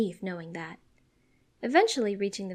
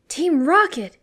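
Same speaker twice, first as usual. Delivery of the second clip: loud, raised angry exclamation; angry outburst; 7 semitones higher, 12 dB louder, slower than usual. A narrator's reading. Ash spotting the villains' balloon, angrily exclaiming. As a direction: spit out the name in anger. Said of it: "he angrily grumbles".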